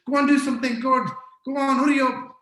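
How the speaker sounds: loud, high, urgent shout; shouting orders. Acted. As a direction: scream at them to get moving. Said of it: "if you're shouting and screaming".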